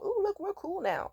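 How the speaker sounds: high sing-song mock of a fake-friendly guy; high sarcastic impression of the ex acting chummy; mocking his friendliness. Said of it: "he's trying to be all".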